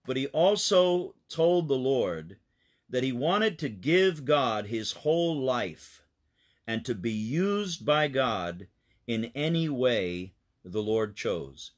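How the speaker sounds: plain reading voice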